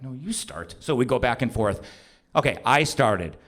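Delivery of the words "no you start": very soft, with "you" stressed; retold whispery-soft comeback; hushed retort. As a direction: quietly throw it back, leaning on "you". Husband passing the job back.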